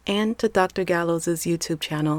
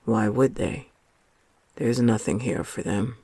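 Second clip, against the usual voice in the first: much lower-pitched story character; George's friend; 6 semitones lower than usual. A narrator's reading. Friend answering George on the path at dusk, low character voice. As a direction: drop well down in pitch for the friend. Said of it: "said his friend".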